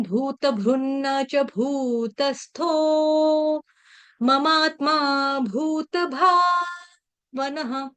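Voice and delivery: slow, measured Sanskrit chanting; shloka recitation. Shown as a demonstration. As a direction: recite each syllable slowly and clearly. Recites Gita shloka slowly. (धीमा, स्पष्ट श्लोक पाठ)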